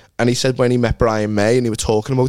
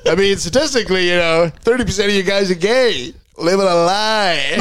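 mimicking Jordan's bit; 8 semitones higher than usual. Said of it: "he starts going like".

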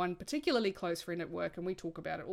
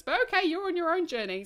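mock exasperated reaction; 11 semitones higher, 7 dB louder than usual. Imagined reaction to hushed friend.

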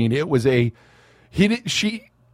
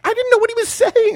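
much higher, bewildered quote; high mock baffled. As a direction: play her total confusion up high. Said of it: "she was like".

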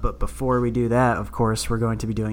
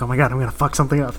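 mock panic; much faster than usual. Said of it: "I have, like, a mini panic attack".

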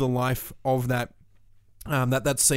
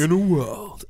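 movie trailer voice; 4 semitones higher, slower than usual. Retold or imitated. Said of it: "brings in the movie guy voice".

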